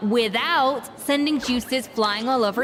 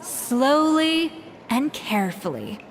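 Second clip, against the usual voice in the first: slow, measured demo pace; slow and careful; 3 semitones lower than usual. Shown as a demonstration. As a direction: chef demonstrates to helpers, slow and deliberate. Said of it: "I'll demonstrate again".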